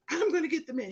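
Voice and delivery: high, loud threat; guard shouting he'll get the manager. Retold or imitated. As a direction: warn him you'll fetch the boss.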